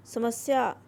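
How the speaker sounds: usual speaking voice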